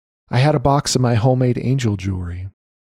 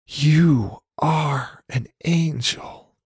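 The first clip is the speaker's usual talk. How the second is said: slow, higher, a touch of imitated sobbing; tearful, retold; 4 semitones higher, much slower than usual. A grieving mother thanks the stranger, mildly tearful. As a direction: echo her tearful thanks, gently. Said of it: "through her sobbing and tears".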